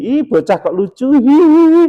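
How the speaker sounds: high, loud gushing coo ending in a giggle; cooing over a grandchild. Retold or imitated. Grandparent teasing a crying grandchild, high gushing coo. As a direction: gush over the chubby-cheeked child. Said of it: "jangan terus gini".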